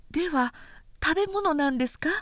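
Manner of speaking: a sister puzzled at her brother's beard; Ichijo, the younger sister. A narrator's reading. Sister asks eagerly.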